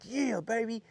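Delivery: high-pitched retold cheer; super pumped. Angler reliving his catch on live, super pumped. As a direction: celebrate in a high, hyped voice. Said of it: "so I'm super pumped".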